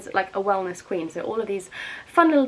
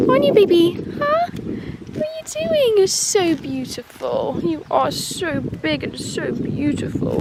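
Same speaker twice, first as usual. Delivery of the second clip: higher, slow cooing pet voice; 7 semitones higher, slower than usual. Real, not acted. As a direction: fuss over the pony. Cooing at the mare.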